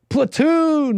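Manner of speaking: high, stretched-out exclamation of the title; excited drawn-out exclamation. Real, not acted. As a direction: call it out high and long, savouring it. Excited host hollers the film title.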